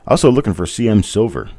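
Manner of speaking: usual speaking voice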